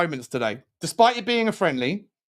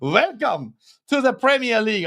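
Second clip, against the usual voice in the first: bad French accent, pitched up; mock French accent; 5 semitones higher than usual. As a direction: welcome them in a put-on, dubious French accent. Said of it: "I'm doing a French accent".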